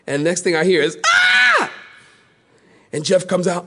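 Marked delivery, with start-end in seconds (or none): 1.1-1.7 very high short shriek; startled scream. Retold or imitated. Dad bursts into wrong mother's room, shrieking. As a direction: shriek in shock.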